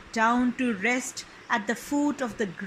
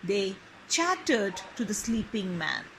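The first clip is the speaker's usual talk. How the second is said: usual speaking voice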